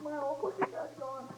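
high, soft, drawn-out wail; hammed-up tearful whine; mock crying. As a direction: imitate a wife wailing over a lost pet. She just broke down and started crying.

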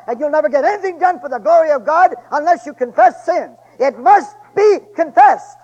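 preacher pounding home the need to confess; full-force preaching. Acted. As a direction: build it up and hammer every clause. Preacher builds to a climax.

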